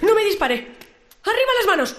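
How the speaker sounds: panicked blurt. Acted. Startled intruder blurts out.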